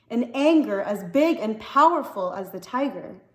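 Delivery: plain reading voice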